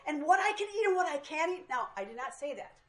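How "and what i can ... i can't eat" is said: high, loud, half-laughing mock outrage; laughing, high-pitched fake fury; mock indignant outburst. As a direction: play the inner tiger roaring back, hammed up. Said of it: "the anger inside of me was like".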